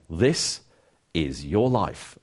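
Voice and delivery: TV presenter's catchphrase, pausing after the first word; low, drawn-out reveal to a surprised celebrity; Eamon Andrews. Retold or imitated. Host surprising celebrities with the red book, slow presenter delivery. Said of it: "program some years ago where Eamon Andrews".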